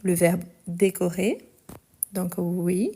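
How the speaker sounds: usual speaking voice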